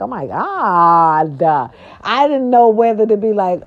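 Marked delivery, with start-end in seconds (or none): 0.0-1.7 slow drawn-out oh my God; shocked gasp. Retold or imitated. Vlogger reacting to a vulgar story, slow drawn shock. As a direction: let the shock stretch out slowly. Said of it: "I was like".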